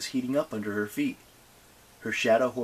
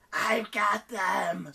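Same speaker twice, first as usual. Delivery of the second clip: slow, high demon triumph; demon scream; 10 semitones higher, much slower than usual. Acted. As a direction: drag out the triumph. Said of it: "screamed Inarai".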